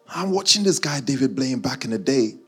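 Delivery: ordinary talk, nothing marked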